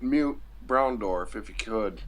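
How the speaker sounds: ordinary talk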